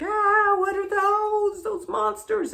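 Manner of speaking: high, loud yelp of alarm; shrieky retold fright; terrified. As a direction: act out panic at monsters. Acted terror on seeing cars.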